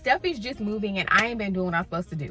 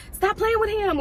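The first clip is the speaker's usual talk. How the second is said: scolding the characters; 11 semitones higher than usual. Retold or imitated. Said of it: "I really can't run out and be like".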